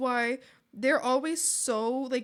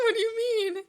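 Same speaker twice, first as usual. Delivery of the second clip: mock indignant; 10 semitones higher, 4 dB louder than usual. Mock offended reply to chat.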